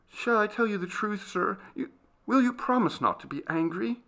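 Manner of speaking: put-on high, tentative girl's voice; nervous maid seeking permission; timid servant girl, pleading. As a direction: ask timidly, pitched high.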